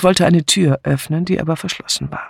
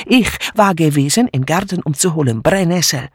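higher, jumpy, insistent broken German; agitated refugee maid; 5 semitones higher than usual. Acted. Mitzi insisting to the inspector, agitated and defiant. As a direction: pour out the accusation, wounded. Mitzi tells what she heard.

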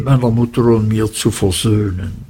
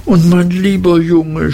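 mild cry out; 6 semitones higher than usual. A narrator's reading. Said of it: "schrie meine Mutter".

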